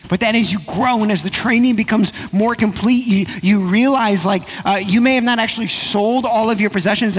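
impassioned pulpit preaching, pitched high. Acted. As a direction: build the sermon to full force, pressing each line. Preaching builds to full force.

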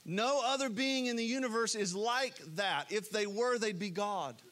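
ordinary delivery

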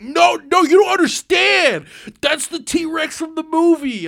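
high, loud insisting yell; insistent shout. Retold or imitated. Brother insisting to the kid it's the movie T-Rex, loud high shout. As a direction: make them understand, shouting it high. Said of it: "And I'm like".

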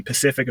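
"Pacific" said in place of specific; mispronunciation. Retold or imitated. Imitating people who mispronounce specific as "Pacific". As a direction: drop the first s, saying "Pacific". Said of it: "that's a mispronunciation".

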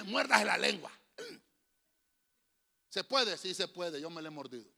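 ordinary talk, nothing marked

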